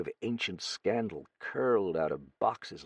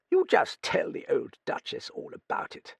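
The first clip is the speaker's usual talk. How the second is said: put-on high, crusty old British lady voice; old Duchess; 7 semitones higher than usual. A narrator's reading. Old Duchess quizzing the young writer, high crusty voice. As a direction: play a brusque, nosy old dowager.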